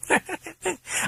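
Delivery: shrill mocking laugh; high-pitched amused laugh; high cackle. A narrator's reading. British voice laughs.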